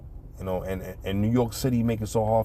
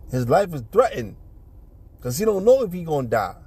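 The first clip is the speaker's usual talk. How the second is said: ordinary talk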